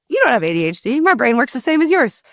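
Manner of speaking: mock a mother's protest, rising in pitch; imitated dismissive mom. Voices mom dismissing the diagnosis.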